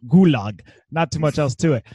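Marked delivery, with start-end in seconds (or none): 0.1-0.6 put-on Russian accent. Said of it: "in a Russian accent".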